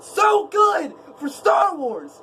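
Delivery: high, heated shouting at Disney remakes; angry rant. Real, not acted. Vlogger ranting at Disney about remakes, shouting angrily. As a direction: rant at the top of your voice, fed up. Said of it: "I'm getting way too angry".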